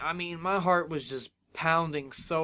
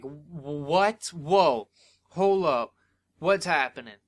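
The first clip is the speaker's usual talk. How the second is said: mock startled, louder bursts; retold disbelief; 5 dB louder, slower than usual. Fan reacting to the comeback, surprised. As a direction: act out being caught off guard.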